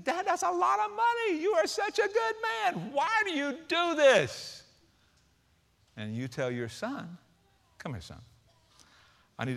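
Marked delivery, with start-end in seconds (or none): 0.0-4.6 put-on high child voice, slow at the end; little boy amazed at his father's giving, high-pitched. 7.8-8.2 low and soft; father beckoning his son, soft and tender. Acted. Father calls son close.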